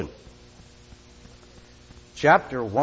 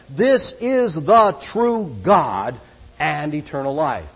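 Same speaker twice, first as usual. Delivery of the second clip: measured, solemn Bible reading; scripture reading; 4 semitones higher, 6 dB louder than usual. Acted. Preacher reading scripture to the congregation, solemn.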